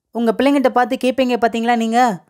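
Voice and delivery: normal talking voice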